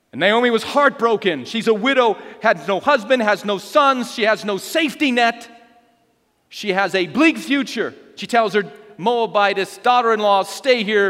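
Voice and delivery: high, emphatic dramatic storytelling; impassioned Bible narration. Real, not acted. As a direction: hammer out each loss in turn. Preaching the story at force.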